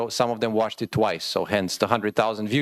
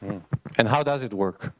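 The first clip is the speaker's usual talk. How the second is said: usual speaking voice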